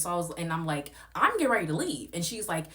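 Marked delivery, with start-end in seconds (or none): 1.1-2.1 high, loud impatient retort; loud high declaration to the host; heated retort. Retold or imitated. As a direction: declare it, fed up. Own retort in argument.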